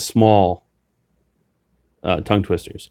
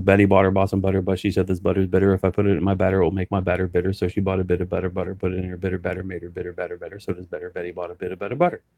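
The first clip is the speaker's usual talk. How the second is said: quick, softer run through Betty Botter; faster tongue twister; 2 semitones lower, 7 dB quieter, faster than usual. Shown as a demonstration. Auctioneer rattles the tongue twister at speed, softer. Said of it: "you get faster and faster and faster".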